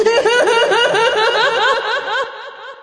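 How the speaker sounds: long rising giggle; a drawn-out high laugh. Real, not acted. Laughs at host's joke.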